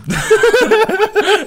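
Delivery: bursting out laughing, high and loud; real loud cackle. Real, not acted. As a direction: let a big real laugh out. Laughing at own story.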